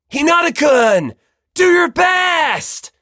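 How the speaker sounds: loud, high, drawn-out cheer; loud high slow shout; cheering call. Acted. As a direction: cheer him on from the hill. Komaeda cheers from afar.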